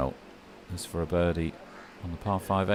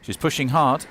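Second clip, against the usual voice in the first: excited; 7 semitones higher, 8 dB louder than usual. Real, not acted. Player closing the gap.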